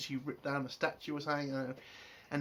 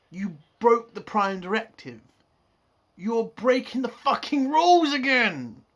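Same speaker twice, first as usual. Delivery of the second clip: loud, high angry outburst at Mariner; angry rant; 6 semitones higher, 9 dB louder than usual. Real, not acted. Fan yells at Mariner for breaking the Prime Directive, angry shouting. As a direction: yell it like a furious fan losing patience. Ranting about breaking the rules.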